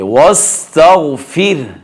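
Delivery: वस्तगफिर drawn out, slowing on ghayn; pausal recitation. Shown as a demonstration. Teacher modelling the stop, pausal recitation. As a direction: slowly close वस्तगफिर on a thin r. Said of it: "आवाज़ निकालेंगे".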